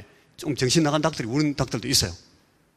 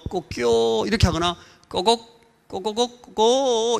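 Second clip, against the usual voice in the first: high clucking kko-gok kko-kko-gok; dialect rooster crow; 9 semitones higher, much slower than usual. Shown as a demonstration. Preacher showing dialect rooster sounds, high clucking. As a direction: cluck it out high like a country hen. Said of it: "이렇게 울어요".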